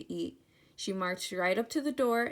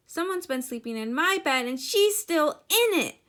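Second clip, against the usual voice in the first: high, loud little bear crying out; Baby Bear; 7 semitones higher, 7 dB louder than usual. A narrator's reading. Baby Bear finds Goldilocks in bed, crying out high. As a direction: build to a high, loud squeal. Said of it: "Baby Bear cried".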